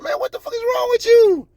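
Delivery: very high-pitched, incredulous cry; exasperated outburst. Real, not acted. Vlogger scolding a man who talked to cops, exasperated outburst. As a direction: let exasperation send the voice way up.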